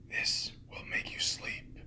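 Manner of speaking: usual speaking voice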